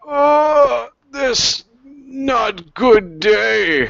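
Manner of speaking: slow, high-pitched creature lament; dying creature. Acted. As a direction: draw out a high, broken-English complaint as you fall. Voices a toppling foe.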